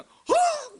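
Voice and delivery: very high imitated gasp of fright; startled gasp. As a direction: mimic a passenger's sudden startle. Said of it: "깜짝깜짝 차가 놀라잖아요". (깜짝 놀란 높은 비명 흉내)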